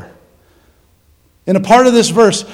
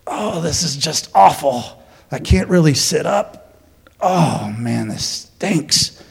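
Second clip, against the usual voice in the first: groaning in pain; 5 semitones lower, 7 dB quieter, slower than usual. Acted. Paul groaning in prison pain.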